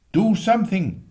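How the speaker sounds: loud, high cry for help; a flustered husband shouting in the smoke; desperate plea. Acted. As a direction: beg the boys to act. Mr Prothero pleading in smoke.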